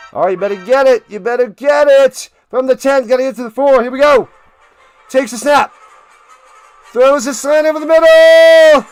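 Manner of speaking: loud, high-pitched live football call; excited play-by-play. Real, not acted. Commentator calling a slant over the middle, excited shouting. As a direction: build to a shouted peak, then draw out the letdown.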